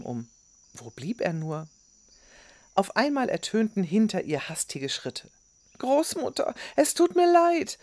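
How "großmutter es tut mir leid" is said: put-on high, loud, breathless boy's apology; keuchend. A narrator's reading. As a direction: gasp out the apology in a much higher voice. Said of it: "keuchte der Junge". (außer Atem keuchend)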